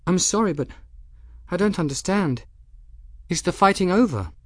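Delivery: put-on light young woman's voice, realising her mistake; young woman Bridget, sheepish. A narrator's reading. Bridget confused, asking Benedict.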